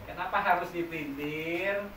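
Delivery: ordinary talk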